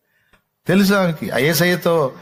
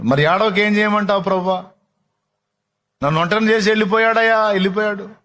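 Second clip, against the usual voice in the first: high, loud anguished cry; weeping plea; 8 semitones higher, 4 dB louder than usual. Acted. Mary pleading to the gardener, tearful. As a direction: plead through tears, fast.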